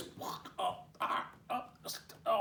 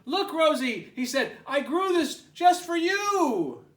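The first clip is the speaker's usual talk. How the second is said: 1 proud excited; 13 semitones higher, 11 dB louder than usual. Acted. Hank proud offering.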